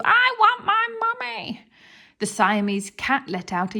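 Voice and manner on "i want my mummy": much higher, frightened cry; scared cry. Acted. Hugh the thief crying out in fright in the dark. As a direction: cry out like a scared little boy. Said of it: "cried Hugh".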